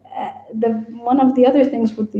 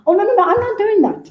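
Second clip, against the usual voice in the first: higher-pitched, lightly acted protest; mock refusal; 10 semitones higher than usual. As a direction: refuse point-blank as a stubborn character. Voices a character refusing the writer.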